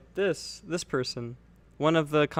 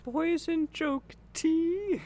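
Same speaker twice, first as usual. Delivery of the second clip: high, slow silly question; goofy high echo; 13 semitones higher, much slower than usual. Acted. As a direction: repeat it in a squeaky voice. Echoing in high voice.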